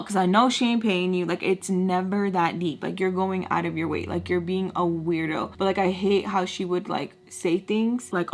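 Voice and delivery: ordinary talk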